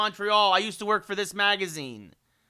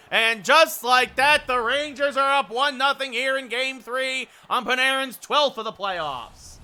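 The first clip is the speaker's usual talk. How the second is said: building to a loud, high, drawn-out goal call; excited play-by-play; 6 semitones higher, 10 dB louder than usual. Pretend. Announcer calls Panarin's goal for the Rangers, excited shouted play-by-play. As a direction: erupt on the score, hold it big, then ease down.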